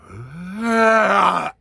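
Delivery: backwards lion roar. Shown as a demonstration. Lecturer showing a lion played backwards, swelling roar. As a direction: start small and build to a loud roar. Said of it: "If it's a backwards lion".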